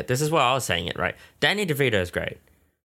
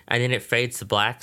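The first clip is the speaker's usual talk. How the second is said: ordinary delivery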